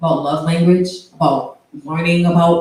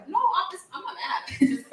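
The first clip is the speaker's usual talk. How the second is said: giggling through the words; real giggle, mock-denying; laughing; 13 semitones higher, 7 dB quieter than usual. Real, not acted. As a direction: laugh it off, half breathless. Host laughs off teasing complaint.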